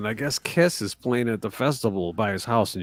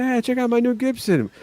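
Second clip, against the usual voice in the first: high-pitched livestream greeting, imitated; imitating an eager streamer's high call; mock excited show-off; 11 semitones higher than usual. Retelling a livestream show-off moment.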